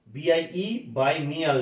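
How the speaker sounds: ordinary talk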